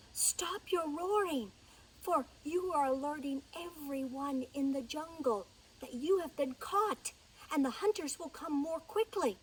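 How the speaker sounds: high, hushed-then-insistent mouse voice; lightly voiced anxious mouse; urgent mouse warning. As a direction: warn urgently in a little voice. Mouse warns the trapped lion.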